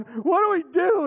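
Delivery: high, loud, slow cry of despair; in tears, begging for an answer; panicked parent. Retold or imitated. Parent driving home from college drop-off, crying out.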